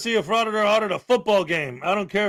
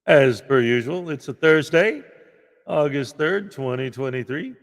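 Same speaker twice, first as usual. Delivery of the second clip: ordinary talk, nothing marked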